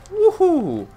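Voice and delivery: very high whoop sliding down; falsetto woohoo. Real, not acted. Gamer celebrating his karate chop, falsetto whoop. Cheers a kill.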